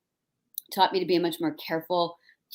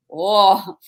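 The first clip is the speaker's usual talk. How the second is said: put-on loud Minnesota-accented "oh"; mock a rural Minnesota neighbor; 2 semitones higher, 9 dB louder than usual. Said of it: "my neighbors in rural Minnesota are like".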